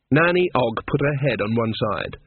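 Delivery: usual speaking voice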